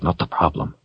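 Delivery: soft inner thought; hushed self-reminder; talking to himself. A narrator's reading. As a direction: quietly correct yourself. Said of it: "he told himself".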